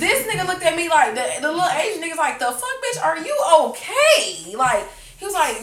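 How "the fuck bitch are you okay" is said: high, loud, drawn-out disbelief; mock incredulous. Bystander to woman worrying about payment, mock incredulous. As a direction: imitate baffled concern, stretching the question.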